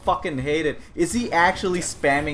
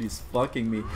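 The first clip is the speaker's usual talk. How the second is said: normal talking voice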